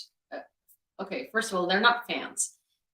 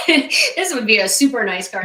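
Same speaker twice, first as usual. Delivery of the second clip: laughing through the words, a bit louder; 6 semitones higher, 9 dB louder than usual. Real, not acted. Streamer laughing at a nice car on screen, laughing. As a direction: giggle while wishing for the car.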